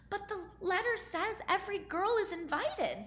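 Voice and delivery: lightly voiced insistent girl; insisting. A narrator's reading. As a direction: press the point gently. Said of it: "Cinderella insisted".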